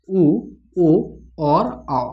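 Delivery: slow held vowel demo; drawn-out vowels. Shown as a demonstration. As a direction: say उ, ओ and आ slowly, each vowel held long. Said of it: "इसका उच्चारण करके देखिए".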